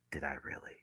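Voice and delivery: barely audible whisper to oneself; hushed, low self-questioning; whispered self-doubt. Real, not acted.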